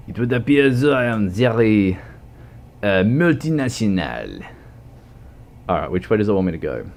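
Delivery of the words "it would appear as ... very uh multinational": hammed-up French accent, pitched higher. Puts on exaggerated French accent.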